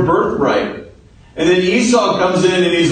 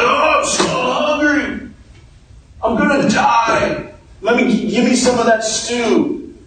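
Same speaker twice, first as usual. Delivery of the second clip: Esau; 8 semitones higher than usual. Acted. Starving Esau begging his brother for stew, melodramatic. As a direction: whine melodramatically in a high, stretched-out voice. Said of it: "Esau was kind of a drama queen".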